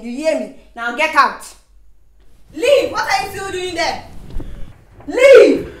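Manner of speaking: loud shouted orders, rising high; angry dismissal. Acted. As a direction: yell at someone to get out. Ordering intruder out.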